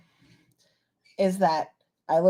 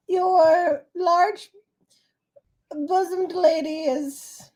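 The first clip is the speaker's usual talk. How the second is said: lightly pompous king voice; warm regal boom; 8 semitones higher than usual. Acted. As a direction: greet your subjects grandly.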